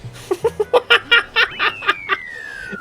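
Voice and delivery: very high real laughter; squealing laugh. Real, not acted. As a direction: burst out laughing. Finishing absurd lap.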